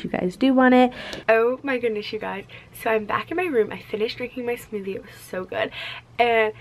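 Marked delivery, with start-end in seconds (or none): none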